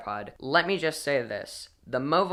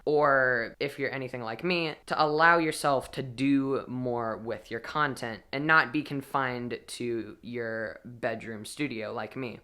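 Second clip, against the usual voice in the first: ordinary delivery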